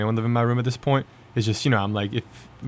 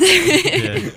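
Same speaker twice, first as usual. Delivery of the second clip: laughing; 6 dB louder than usual. Real, not acted. Laughing reply.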